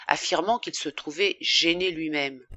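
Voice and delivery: plain reading voice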